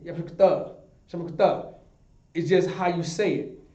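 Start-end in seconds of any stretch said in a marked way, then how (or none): 0.0-1.7 "Yahweh Barakatah" said a touch louder and run together; run-together form. Retold or imitated. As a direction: clip "Shem Barakatah" the casual way. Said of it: "you also hear brothers saying".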